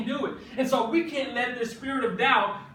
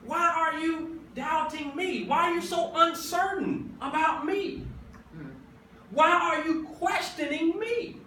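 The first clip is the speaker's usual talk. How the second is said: pleading Jesus; 5 semitones higher than usual. Retold or imitated. Jesus asking his doubting people why, higher and pleading. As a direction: press the question like a hurt friend. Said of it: "I've shown you what I can do".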